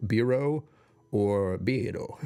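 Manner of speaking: Biro said with an odd put-on Japanese accent, slow drawn-out "Biro"; playful mock Japanese "Biro"; weird accent. Shown as a demonstration. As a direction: try "Biro" in a silly foreign accent. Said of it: "I don't know why I gave it a weird accent".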